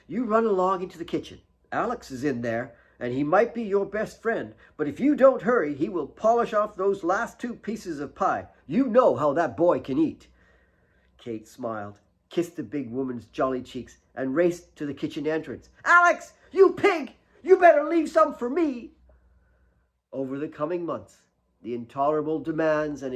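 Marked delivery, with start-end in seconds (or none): 0.1-10.3 higher, lively motherly voice; playful nanny. Acted. Mary sends Kate to kitchen. 15.8-18.9 put-on high, loud girlish yell; calling out. Kate calling across to Alex.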